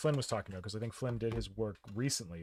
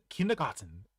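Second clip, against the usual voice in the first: kindergarten said the German way, r-less with crisp t; German pronunciation. Retold or imitated. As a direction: say "kindergarten" with a German accent, no r sounds. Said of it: "we have it in German".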